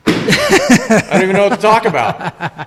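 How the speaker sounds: loud, high, slowed by real laughing; helpless laughter. Real, not acted. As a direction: crack up while getting the words out. Cracks up after seltzer spill.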